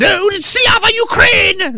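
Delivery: very high, slow mock cheer; shrill hammed-up slogan shout; mocking activist. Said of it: "at the other hand".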